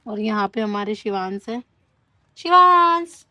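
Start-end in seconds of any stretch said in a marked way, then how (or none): 2.4-3.2 loud high call across the room; calling out to her son. Real, not acted. Mother calling out to child.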